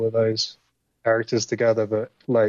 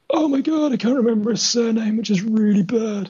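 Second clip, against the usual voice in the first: embarrassed exclaim; 10 semitones higher than usual. Real, not acted. Forgot the voice actor's name.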